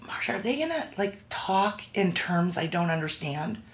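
worried newcomer asking softly; timid. Retold or imitated.

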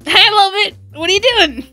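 ordinary delivery

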